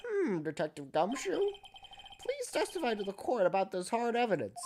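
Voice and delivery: put-on slow, high old judge; very old man. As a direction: ancient judge drawing it out. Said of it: "I gave him the old man voice".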